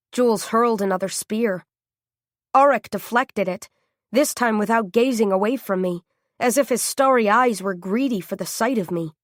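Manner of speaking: ordinary delivery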